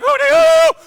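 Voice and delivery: high, loud whooping call; hootie hoo call. Real, not acted. Zuma Dog signing off to council with a loud whoop. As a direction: whoop it out like a victory cry.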